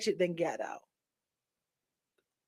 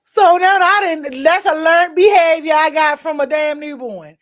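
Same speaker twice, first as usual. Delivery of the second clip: laughing; 8 semitones higher, 9 dB louder than usual. Real, not acted. Laughing at own baby habit.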